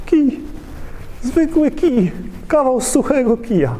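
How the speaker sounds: high, sheepish little voice; a shrugging man admitting he has only a stick; Moses' meek answer. Acted. Moses answering God with a high, sheepish voice.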